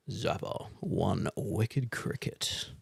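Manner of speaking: low, slow movie-trailer growl. Acted. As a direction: sell it like a film trailer, hushed and slow. Dramatic trailer voice reading box.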